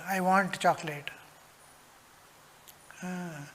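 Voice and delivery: ordinary talk, nothing marked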